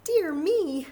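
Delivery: a little mouse in a fluster; a hint of a worried mouse; fretting mouse. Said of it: "Mouse frets".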